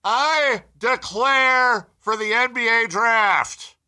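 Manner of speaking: high, slow, full-volume proclamation; top of the lungs. Shown as a demonstration. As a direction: bellow it like a grand public declaration. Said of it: "at the top of your lungs say".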